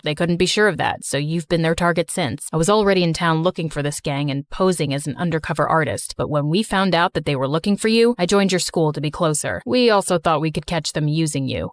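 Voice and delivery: put-on deep, steady young man; calm her down in a low voice; low reassuring male voice. Slater explains.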